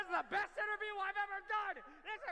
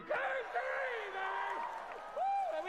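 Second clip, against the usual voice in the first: hype shout; 3 semitones higher, much slower than usual. Real, not acted. Host echoes fan, hypes crowd.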